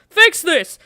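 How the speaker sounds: high, loud frantic yell; panicked shout. Real, not acted. Panicking during town fight.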